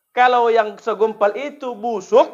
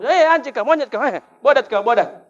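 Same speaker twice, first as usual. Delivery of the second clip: startled swearing; 5 semitones higher, 6 dB louder than usual. Retold or imitated.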